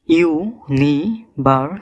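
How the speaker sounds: University slowly split into five syllables; university broken up for learners, one syllable at a time. Shown as a demonstration. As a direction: say university very slowly, a pause after each syllable. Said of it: "পাঁচটি syllable রয়েছে".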